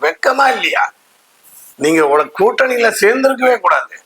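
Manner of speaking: sharp, high outraged demand; indignant rebuke. Real, not acted. Speaker scolding Thirumavalavan for his alliance, indignant high rebuke. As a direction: fling the shame at him, pitch jumping up.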